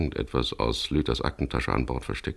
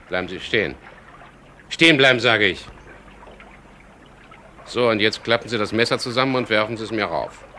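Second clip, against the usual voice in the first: loud, high barked order to stop; sharp command; 7 semitones higher, 5 dB louder than usual. Acted. Skipper ordering the knife-wielding intruder to freeze, shouting.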